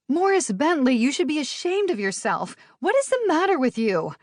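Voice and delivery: high, indignant scolding; Livy, hurt, scolds Bentley after the tackle. Acted. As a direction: tell him off like a stern teacher.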